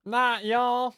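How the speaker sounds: high, loud exaggerated Southern drawl on Not y'all; overdone Southern twang, high and loud; thickest Southern accent. Shown as a demonstration. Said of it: "Thickest accent possible".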